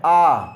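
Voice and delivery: 'आ' said as a long aa with mouth wide open; open-mouthed 'aa'. Shown as a demonstration. Teacher demonstrating a vowel to students, open-mouthed long 'आ'. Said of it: "मुंह खोल के बोला जा रहा है".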